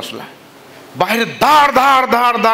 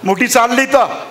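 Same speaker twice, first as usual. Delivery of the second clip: irritated husband; 6 semitones higher than usual. Acted. Husband snaps at his wife to go to sleep. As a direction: brush her off sharply. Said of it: "समानं तिचा हात झटकला".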